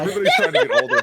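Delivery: laughing. Real, not acted. Laughing, loud.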